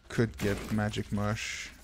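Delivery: ordinary talk, nothing marked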